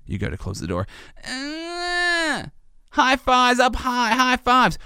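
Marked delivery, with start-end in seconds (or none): 1.2-4.8 put-on high, slow kid's chant of "high fives"; little kid's singsong demand; toddler voice. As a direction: call out like a toddler stalling. Toddler delaying bedtime.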